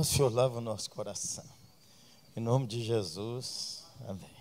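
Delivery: soft, hushed prayer voice; soft confessing prayer. Acted. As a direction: pray quietly and humbly. Pastor leads a prayer.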